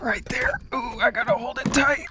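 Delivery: strained high whisper; straining. Real, not acted. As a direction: squeeze it out while holding weight. Straining to hold gutter up.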